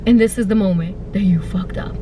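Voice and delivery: low spooky whisper; ominous whisper. Retold or imitated. Narrating her doom in a low whisper.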